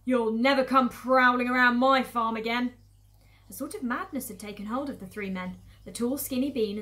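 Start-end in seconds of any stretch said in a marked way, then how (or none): 0.0-2.7 very loud, forceful warning; yelled threat. Acted. Farmer Boggis warning the fox off his farm, yelling. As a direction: bellow it at the intruder. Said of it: "yelled Boggis".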